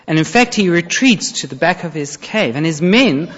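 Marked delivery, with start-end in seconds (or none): none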